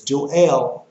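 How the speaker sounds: "Joel" said Jo-EL, second syllable stressed; Joel as two syllables. Shown as a demonstration. Preacher gives his own reading, "Joel" as Jo-EL. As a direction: say "Joel" deliberately as jo-ELL. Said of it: "you say Joel".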